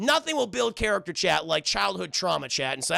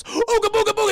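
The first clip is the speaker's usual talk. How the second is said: high, loud monster taunt; hammed-up cartoon bogeyman; spooky monster; 8 dB louder than usual. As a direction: shout it to scare someone. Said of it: "the shadow monster and goes".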